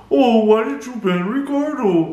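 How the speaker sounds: high mocking whine. Mocking the rival's complaint in a high whine. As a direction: ape his whiny complaint. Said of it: "mocking rival's whiny question".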